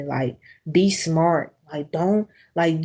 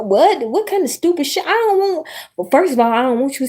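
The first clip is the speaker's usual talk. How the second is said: mock outrage; 9 semitones higher than usual. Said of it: "I was like".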